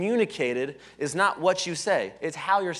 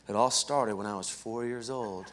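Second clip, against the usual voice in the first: slow, low, soft reminiscing; mock long-winded storyteller; 8 semitones lower, 7 dB quieter than usual. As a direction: settle in for a hammed-up life story. Mocks a long-winded life story.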